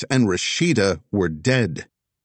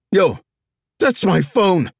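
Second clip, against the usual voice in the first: high, loud outraged yell; shouting; 13 semitones higher, 7 dB louder than usual. Acted. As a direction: yell after her, desperate. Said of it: "Vess shouted".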